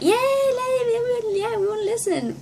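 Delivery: high, cheering fan voice; re-enacted crowd enthusiasm; eager crowd cheer. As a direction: cheer like an excited audience. Acting out crowd's eager reply.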